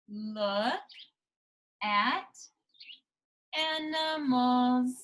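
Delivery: slow, drawn-out reading of the sign. Shown as a demonstration. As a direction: read each word slowly, pausing between them. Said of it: "What does it say?".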